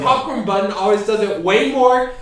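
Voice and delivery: loud, high, insistent rant; heated arguing. Real, not acted. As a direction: hammer the point home loudly. Heated argument over popcorn.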